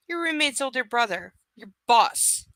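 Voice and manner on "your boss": bitterly. A narrator's reading. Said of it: "he adds bitterly".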